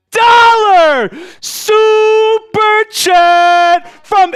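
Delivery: drawn-out high shouts, each word stretched long; hyped donation shout. Real, not acted. Streamer celebrating a five hundred dollar super chat, hyped shout. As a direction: belt it out slowly like a hype announcer.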